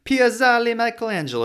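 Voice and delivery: Piazzale Michelangelo sung high in Italian accent; hammed-up Italian, Piazzale Michelangelo with a big rise. Shown as a demonstration. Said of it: "makes me wanna talk in an Italian accent".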